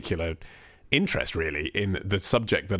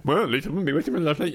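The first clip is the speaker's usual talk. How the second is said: mock indignant retort; 7 semitones higher, faster than usual. Voicing Helen's retort.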